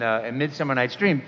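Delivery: ordinary talk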